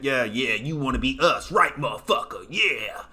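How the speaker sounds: sneering taunting voice; imitating a smug bully taunting; mock taunt. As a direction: rub it in their face. Mocking American taunting foreigners.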